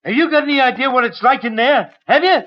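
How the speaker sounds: rising, scornful tirade about prison; fast, bitter rant, pitch climbing; bitter outburst. Acted.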